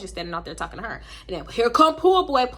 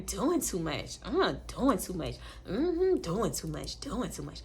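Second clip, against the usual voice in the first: imitated disapproving aside; 2 semitones lower, 6 dB quieter, slower than usual. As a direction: say it under your breath, judging. Overheard muttered disapproval acted out.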